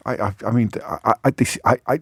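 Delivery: stammered false starts, acted incredulity; put-on speechless stammering; spluttering. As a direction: splutter as if words won't come. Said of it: "we'd be spluttering with".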